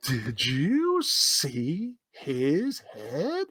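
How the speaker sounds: very slow, stretched-out question; exaggerated, drawn-out mock question; put-on teasing. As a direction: drag out every word to needle someone.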